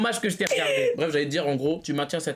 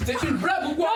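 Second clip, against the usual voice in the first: mock anger; 10 semitones higher than usual. Mock confrontation.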